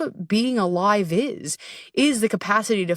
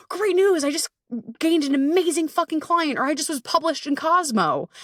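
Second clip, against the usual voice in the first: bursting with excitement; 8 semitones higher than usual. Retold or imitated. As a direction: gush about the big win, pitch way up. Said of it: "I'm like".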